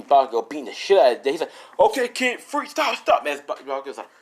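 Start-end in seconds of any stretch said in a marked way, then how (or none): 1.8-3.2 high, urgent shouted order; retold police shout; cop's command. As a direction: order him to halt, voice pitched up. Acted cop ordering fighter to stop.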